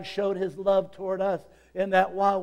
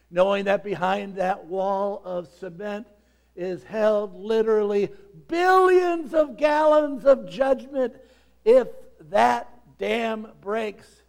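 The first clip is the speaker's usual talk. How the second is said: rising from firm to a slow, loud shout; building preaching shout; 5 semitones higher, 7 dB louder, slower than usual. Real, not acted. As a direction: build the flood of judgment to a full shout. Preacher builds the flood image.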